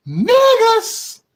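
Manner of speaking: very high, loud yell; screamed exclamation. Acted. Streamer yelling the word for shock. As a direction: scream it out in a strained falsetto.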